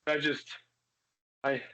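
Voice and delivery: high, ragged catching-breath gasps; breathless huffing, high and shaky; panting. Acted. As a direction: gulp air after a panic attack. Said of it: "You're like".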